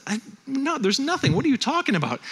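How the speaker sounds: high-pitched acted denial; bewildered protest. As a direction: stammer into a protest, pitch jumped up. Job protests his friends' accusation.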